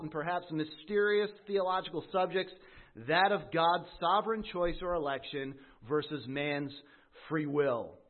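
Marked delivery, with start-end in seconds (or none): none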